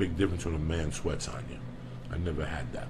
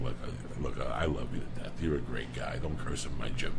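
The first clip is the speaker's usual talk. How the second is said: hushed coach warning; 8 semitones lower, 6 dB quieter than usual. Retold or imitated. Coach Dave scolding, voiced quietly.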